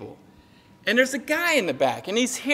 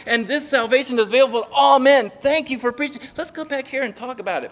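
higher-pitched, hammed-up religious enthusiasm; mock gushing thanks; 4 semitones higher than usual. Priest imagined thanking the apostles, mock gushing enthusiasm. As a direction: pile on the delighted praise, pretending.

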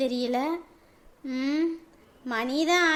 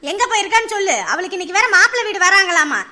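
angry threat; 5 dB louder than usual. Acted. Mother threatening her daughter to tell where the friend went, angry. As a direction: threaten her, hard and loud.

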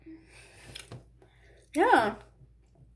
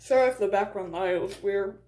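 ordinary talk

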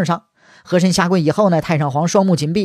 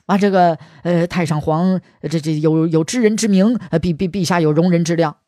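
hesitant, stuttering servant picking his words; nervous stammering. Acted. He Shen forced to answer the emperor, stammering hesitantly. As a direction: stall with fillers and repeated syllables.

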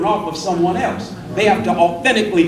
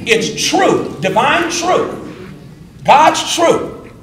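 rising preaching fervor; 7 semitones higher, 4 dB louder, slower than usual. Acted. Preaching climax on truth.

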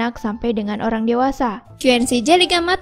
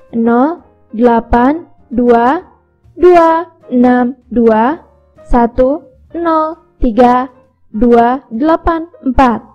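slow dictation; much slower than usual. Acted. Dictating a phone number.

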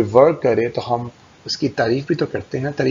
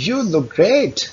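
"You look great" pitched higher, lively praise; excited praise; 7 semitones higher, much slower than usual. Shown as a demonstration. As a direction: sound thrilled for them. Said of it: "आपकी tone जो है ... excited हो". (उत्साहित लहजे में तारीफ)

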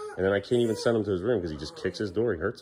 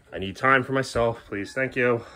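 ordinary delivery

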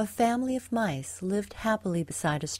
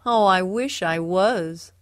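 slow, louder storybook lament; sad; 7 dB louder than usual. A narrator's reading. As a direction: draw it out with wistful regret. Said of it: "the wall sadly said".

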